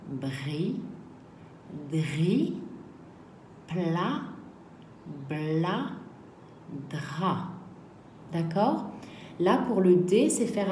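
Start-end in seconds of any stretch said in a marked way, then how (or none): 0.1-7.5 each syllable spaced and clear; slow syllable drill. Shown as a demonstration. Teacher drills syllables for pupils, slow and clear. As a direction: sound out "bri", "dri", "bla", "dra" one by one. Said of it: "Donc je reprends le son P".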